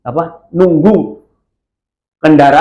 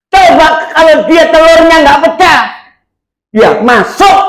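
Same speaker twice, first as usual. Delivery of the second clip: exclaiming; 5 dB louder than usual. Acted. Preacher stresses the near escape.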